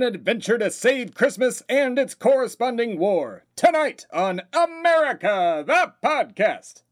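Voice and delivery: slow, booming, high show intro; announcer voice. Acted. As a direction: draw out the show title grandly. Host's grand show intro.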